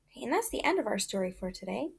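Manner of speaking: ordinary talk, nothing marked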